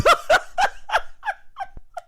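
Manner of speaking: high-pitched cackling laugh; burst of laughter. Real, not acted. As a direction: crack up at your own joke. Cracks up at own joke.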